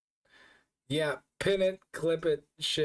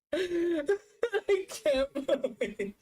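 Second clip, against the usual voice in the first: laughing hard; 13 semitones higher, slower than usual. Real, not acted. Streamer laughing at captured clip.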